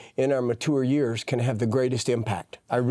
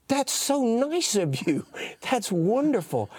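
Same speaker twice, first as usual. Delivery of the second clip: higher, slow, earnest praise; naive young self; 8 semitones higher than usual. Retold or imitated. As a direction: gush innocently about her kindness. Naive driver praising her visit.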